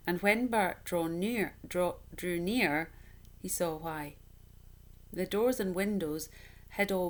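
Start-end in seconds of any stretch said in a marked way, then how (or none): none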